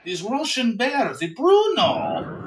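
high, drawn-out Russian bear; slow, hammed-up Russian accent; mock Russian accent. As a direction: put on a playful Russian accent, stretching the words. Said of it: "his ability to do accents".

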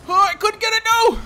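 loud, high wail of dismay; dismayed cry. Real, not acted. Player who just missed the ball, dismayed yell.

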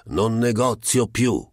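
slow, scornful refusal; disdainful. A narrator's reading. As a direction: dismiss the offer with contempt. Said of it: "rispondendo sdegnosamente". (rifiuto sdegnoso e lento)

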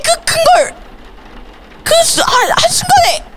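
very high, squealed protest; shocked disbelief. Real, not acted. Player finds the baby ate the big one, shrill shock. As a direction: squeal in appalled disbelief, pitch shooting way up.

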